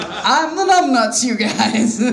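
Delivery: high, slow goofy self-mockery; mock dumb voicemail voice. Comic mocking her own voicemail greeting. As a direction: play the idiot on your own voicemail.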